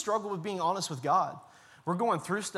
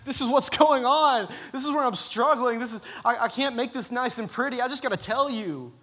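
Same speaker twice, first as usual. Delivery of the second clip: much higher and louder, gushing; mock excited outpouring; 6 semitones higher, 7 dB louder than usual. Friend unloading struggles on her pastor, gushing. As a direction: pour it all out in a rush of relief.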